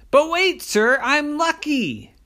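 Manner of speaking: high, slow, pleading little leprechaun; bedtime-story leprechaun voice; leprechaun boy voice. Acted. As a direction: squeak it up like a small hopeful leprechaun. Liam the leprechaun character voice.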